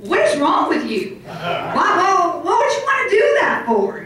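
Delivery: put-on dad scolding a child, laughing, then shouting; imitating dad. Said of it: "He even goes".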